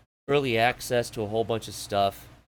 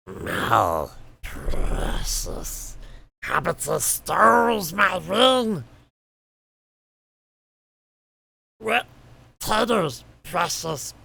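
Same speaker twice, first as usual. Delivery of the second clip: creepy creature voice; 5 semitones higher, 4 dB louder, much slower than usual. Pretend. Gollum-like creature voice.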